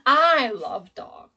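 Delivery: "I" stretched out and loud; stress on I. Shown as a demonstration. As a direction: hold and punch the word "I". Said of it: "my emphasis and my stress is on".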